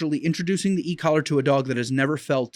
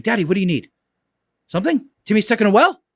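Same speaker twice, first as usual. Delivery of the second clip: lightly voiced eager dog, a bit higher; 6 semitones higher, 6 dB louder, faster than usual. Dog answering the trainer's raised voice, lightly voiced and eager. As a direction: play the dog answering its owner's call, keen. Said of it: "my dogs come running".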